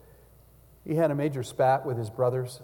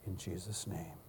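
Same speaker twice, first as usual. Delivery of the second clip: prayer; 5 semitones lower, 11 dB quieter than usual. Real, not acted. Closing prayer.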